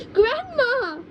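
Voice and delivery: very high scared shout; frightened cry. Acted. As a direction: cry out in fear. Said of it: "he cried out".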